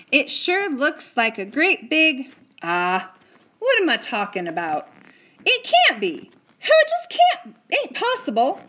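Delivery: cowboy voice rising high in disbelief; a small cowboy who can't believe his eyes; cowboy staring up at a giant boy, stunned and high; amazed cowboy. A narrator's reading.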